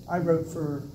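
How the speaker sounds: usual speaking voice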